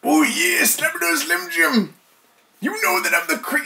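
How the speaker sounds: hammed-up Macho Man voice; Macho Man impression. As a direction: cut a wrestling promo. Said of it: "Macho Man Randy Savage".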